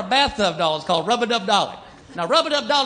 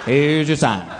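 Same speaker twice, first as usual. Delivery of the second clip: punchline; 8 semitones lower, much slower than usual. Acted. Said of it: "punchline catchphrase".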